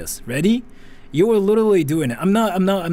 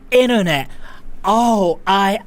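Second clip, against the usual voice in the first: mock American "internet" with a hard flapped t; American accent; 3 semitones higher, slower than usual. Streamer mocking how Americans say "internet", in an American accent. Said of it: "Americans say, like".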